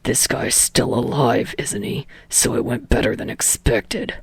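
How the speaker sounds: low, breathy deadpan jab; the grumpy star teasing his co-star on TV; dramatic huff. Acted. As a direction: huff it out, mock-exasperated. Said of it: "huffs dramatically".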